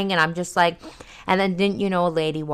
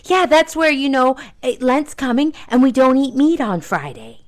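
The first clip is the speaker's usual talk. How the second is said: high, light mock voice of the lady; chirpy churchgoing lady; 8 semitones higher, 4 dB louder than usual. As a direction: play the lady a bit sing-song. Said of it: "she goes".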